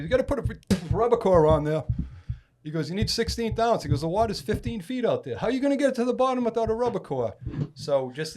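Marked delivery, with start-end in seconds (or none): 0.0-1.8 higher, louder, imitating a bossy buddy; insistent urging. Fishing buddy correcting his rig, insistent urging. Retold friend urging him on tackle. 2.9-7.3 high-pitched, agitated imitation of a friend; exasperated lecturing. Fishing buddy scolding him about the rig, exasperated lecturing.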